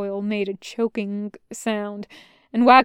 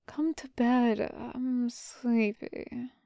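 very soft, slow and drowsy; faint, dragging, half-asleep voice; sleepy murmur; 2 semitones higher, 9 dB quieter, much slower than usual. A narrator's reading. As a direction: drift off as you coax someone to bed. Injured general murmurs, half-asleep.

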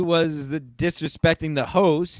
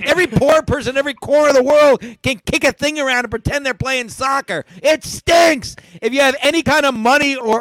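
ranting shout; 6 semitones higher than usual. Real, not acted. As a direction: rail at the sport, voice cracking high. Rant against soccer.

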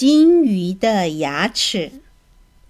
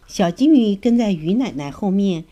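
usual speaking voice

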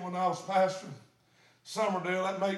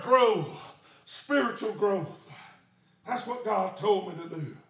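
ordinary talk

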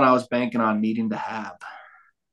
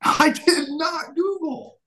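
indignant denial; much slower than usual. Real, not acted. Accused of cheating, protests.

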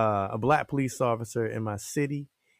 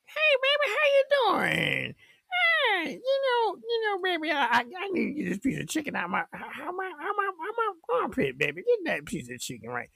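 put-on very high falsetto older lady voice, calling everyone baby; high-pitched auntie voice, quick and rambling; Miss Sharon. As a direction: squeak it like a sweet old lady rambling about chicken. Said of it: "that lady, Miss Sharon".

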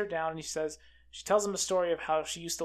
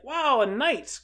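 acted-out amazement, higher and louder; impressed; 6 semitones higher, 7 dB louder, much slower than usual. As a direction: imitate a host marvelling at a guest. Lord marvels at meeting a knight.

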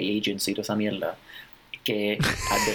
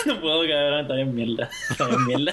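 laughing; 5 semitones higher than usual. Real, not acted. Friend cracks up at joke.

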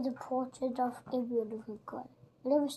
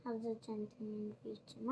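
whispered; 3 semitones lower, 10 dB quieter than usual. A narrator's reading. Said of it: "he whispered".

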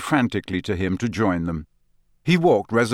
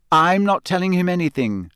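curt, higher refusal; short; 9 semitones higher, 4 dB louder than usual. A narrator's reading. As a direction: cut him off briskly. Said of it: "Hermione said shortly".

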